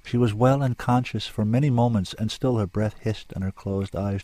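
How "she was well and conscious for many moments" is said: ordinary delivery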